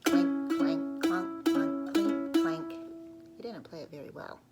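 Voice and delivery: ordinary delivery, nothing marked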